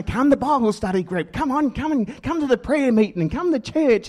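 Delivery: high, insistent mock voice of the church family; pushy churchgoer. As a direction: badger him sweetly to come along. Family nagging him to church.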